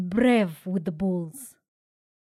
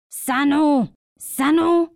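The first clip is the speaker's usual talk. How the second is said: higher, louder calls; shout; 6 semitones higher, 7 dB louder, slower than usual. A narrator's reading. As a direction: call out the name. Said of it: "shouts the elders".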